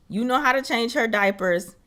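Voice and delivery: ordinary delivery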